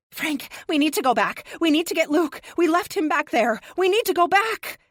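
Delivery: frantic loud pleading with Frank; urgent pleading. A narrator's reading. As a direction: press him hard to go back. Shaking Frank, urging him.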